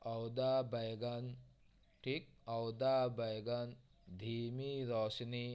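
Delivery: phrases read slowly, x0.6, each word distinct. Shown as a demonstration. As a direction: dictate slowly for students to follow. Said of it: "इस तरीके से". (धीमी गति से वाक्यांश पढ़ना)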